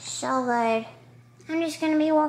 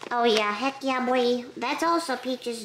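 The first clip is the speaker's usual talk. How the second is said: usual speaking voice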